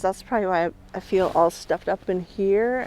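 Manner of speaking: usual speaking voice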